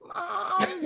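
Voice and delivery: high, soft mimicry; Molly. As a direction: imitate a daughter calling for mom. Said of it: "in her Molly voice".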